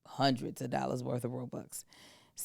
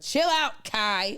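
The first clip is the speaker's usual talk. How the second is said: mock kid brush-off; 6 semitones higher, 3 dB louder, slower than usual. Brother telling twin off.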